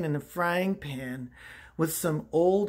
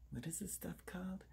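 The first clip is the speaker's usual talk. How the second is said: very soft, quick whisper; whispered aside; 23 dB quieter, faster than usual. Acted. Host forgetting an ingredient's name, quick whispered aside.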